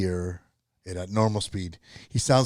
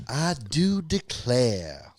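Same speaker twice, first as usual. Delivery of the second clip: very slow, higher mock Southern belle; 4 semitones higher, much slower than usual. Trying the Southern belle for friends, hammed-up drawl. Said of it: "like, a Southern belle".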